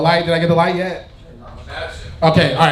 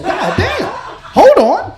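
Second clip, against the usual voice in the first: stunned exclamation; 11 semitones higher, 4 dB louder, much slower than usual. Retold or imitated. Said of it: "I said".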